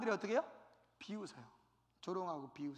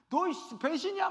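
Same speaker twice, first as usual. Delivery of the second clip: angry accusation; 8 semitones higher, 5 dB louder than usual. Retold or imitated. Old accomplice angry at refusal.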